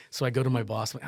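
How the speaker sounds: usual speaking voice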